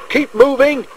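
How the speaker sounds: high, urgent call; alarmed shout. A narrator's reading. Driver urges Percy over the bridge, high alarmed call. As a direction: urge him on in alarm, voice pitched right up. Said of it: "shouted his driver in alarm".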